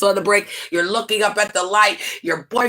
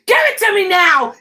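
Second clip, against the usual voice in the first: raised, high-pitched mock outburst; high, indignant demand; demanding boyfriend; 10 semitones higher, 3 dB louder than usual. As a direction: act out a boyfriend demanding his car back. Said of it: "Who just says".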